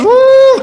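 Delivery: very high, loud "woo"; exaggerated falsetto sob; mock hooting cry. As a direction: switch to a pursed-lip wail. Child switches to muted cry.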